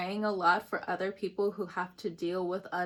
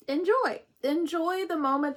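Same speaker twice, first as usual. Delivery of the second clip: bright emphatic enjoy; 7 semitones higher, 5 dB louder than usual. Real, not acted. Emphatic burst.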